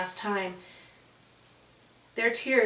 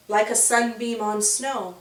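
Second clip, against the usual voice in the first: ordinary delivery, nothing marked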